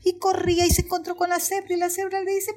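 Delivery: ordinary delivery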